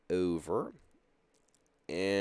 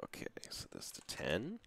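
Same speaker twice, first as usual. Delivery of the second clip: soft whispered aside; 10 dB quieter than usual. Real, not acted. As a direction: whisper it to yourself.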